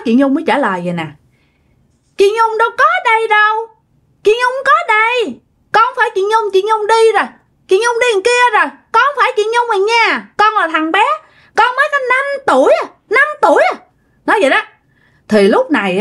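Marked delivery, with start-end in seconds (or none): 2.2-13.8 put-on high, sing-song five-year-old; imitated child voice, pitched way up; little child's voice. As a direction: play a small boy speaking through her. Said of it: "giọng một đứa con nít".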